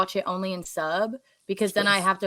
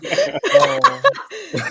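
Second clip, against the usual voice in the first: high cartoon anime cackle; Luffy laugh; 5 dB louder than usual. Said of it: "That was my impression of Luffy".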